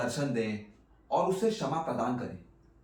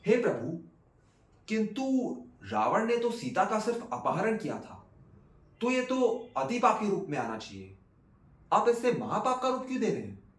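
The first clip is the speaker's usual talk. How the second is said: higher-pitched, questioning objection; interrupting Parvati; 7 semitones higher than usual. A narrator's reading. Parvati questions Shiva over Ravan's sin, protesting in a high voice. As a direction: voice Parvati challenging her lord. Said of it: "Parvati जी ने शिव जी को टोका और पूछा".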